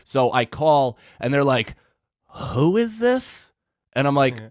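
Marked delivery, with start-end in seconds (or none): 2.3-3.5 aloof clerk. Retold or imitated. Store clerk answering a customer's call, aloof. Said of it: "it was, like, so aloof".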